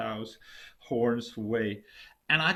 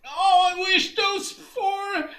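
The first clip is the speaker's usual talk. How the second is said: much higher, louder, slow wailing curse; spiteful wish; 10 dB louder, slower than usual. Acted. Husband hurls a spiteful wish at his wife, wailing. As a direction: drag out the wish in furious despair.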